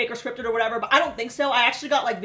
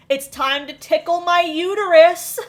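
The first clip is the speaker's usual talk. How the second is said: loud, higher mock announcement; announcing; 5 semitones higher, 8 dB louder than usual. Hostess to her IVF party guests, mock announcing. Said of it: "she announces".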